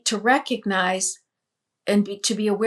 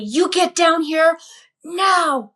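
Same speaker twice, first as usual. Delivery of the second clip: high, loud order; commanding scream; 7 semitones higher, 5 dB louder, slower than usual. Retold or imitated. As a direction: order her down with fierce authority. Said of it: "and commands me".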